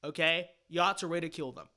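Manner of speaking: normal talking voice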